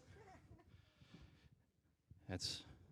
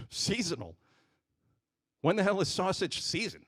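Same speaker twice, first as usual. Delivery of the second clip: incredulous; 5 semitones higher than usual. Retold or imitated. Incredulous retort to the clerk.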